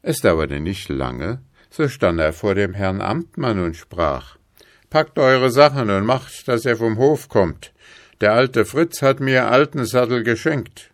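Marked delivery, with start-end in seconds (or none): 4.9-10.9 loud, higher voice ordering someone out; triumphant farmer, commanding. Acted. Farmer turning the bailiff off the estate, commanding. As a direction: announce your new ownership forcefully.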